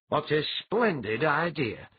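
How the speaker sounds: bright, slow, mildly eager approval; a touch of delighted enthusiasm; enthusiastic. A narrator's reading. Old chief delighted by the wedding visit idea, mildly enthusiastic. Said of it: "said enthusiastically".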